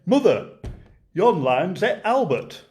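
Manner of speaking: a Lancashire pa blurting the news to his wife, dragging the words; Lancashire dad. Acted. Pa telling Mother the lion ate Albert, Lancashire voice. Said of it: "my best Lancashire voice".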